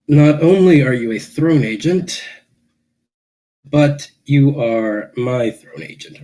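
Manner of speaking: slow, pointed emphasis; stressed pointed speech. Acted. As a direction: lean on only and my. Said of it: "he says".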